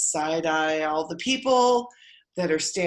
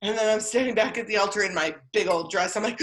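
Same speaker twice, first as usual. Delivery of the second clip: normal talking voice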